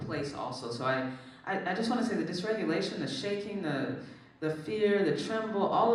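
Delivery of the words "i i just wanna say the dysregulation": ordinary talk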